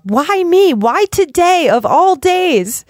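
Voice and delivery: put-on high, slow childish whine of self-pity. Speaker imitating her own self-pity over the stalled trip, whiny. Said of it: "immature self-pity that can come up".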